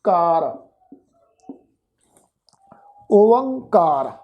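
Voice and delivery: ਕਾਰ alone, then ਓਅੰਕਾਰ slowly joined; pronunciation demo. Shown as a demonstration. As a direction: build ਓਅੰਕਾਰ from ਕਾਰ, long and falling. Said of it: "ਤੋ ਇਹਦਾ ਉਚਾਰਨ ਬਣੇਗਾ". (ਕਾਰ, ਫਿਰ ਹੌਲੀ ਜੋੜ ਕੇ ਓਅੰਕਾਰ)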